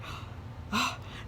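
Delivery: a sharp breathy mock gasp of outrage; shocked gasp. Offended lady gasps at Lizzie's refusal, mock gasp. Aristocrat reacts to Lizzie's defiance.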